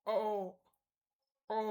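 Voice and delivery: অ repeated, a rounded vowel; German Ö sound. Shown as a demonstration. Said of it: "এটাকে বলা হয় ও umlaut".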